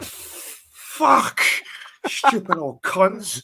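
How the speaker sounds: high, hammed-up angry swearing, slowed at the end; mock outburst. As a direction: fake fury at dawdling old tourists. Mock rage at slow tourists.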